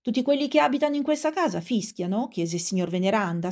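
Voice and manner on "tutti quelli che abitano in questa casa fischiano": high-pitched, projected questioning; mildly shouted, incredulous question; calling out, still shouting. A narrator's reading. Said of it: "sempre gridando".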